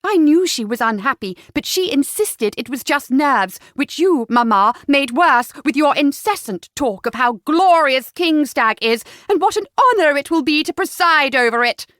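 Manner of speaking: forceful, exasperated daughter; temper snapping. A narrator's reading. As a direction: scold the parents indignantly. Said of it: "Cleo's temper finally snapped".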